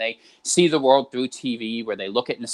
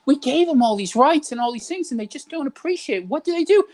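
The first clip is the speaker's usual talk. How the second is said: put-on much higher, huffy old-timer voice; indignant boomer; 12 semitones higher, 3 dB louder than usual. Boomer grumbling about ungrateful people, high indignant voice. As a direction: complain like an aggrieved elder, pitched way up. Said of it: "So they say".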